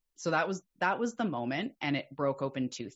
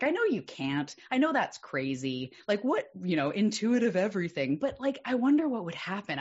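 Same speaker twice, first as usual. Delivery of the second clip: raised, louder voice reasoning with oneself; curious inner debate; 7 semitones higher, 6 dB louder than usual. Retold or imitated. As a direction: retell an excited what-if thought. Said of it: "in my mind, it was like".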